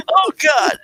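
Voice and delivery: helpless laughing fit, tears streaming, trying to talk through it; crying with laughter. Real, not acted. Host apologizing to viewers while crying with laughter. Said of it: "no way I can stay on the lines laughing like this".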